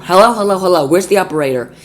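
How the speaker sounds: loud, high, repeated hellos; impatient phone shouting. Acted. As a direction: bark for the operator. Jiggling phone furiously.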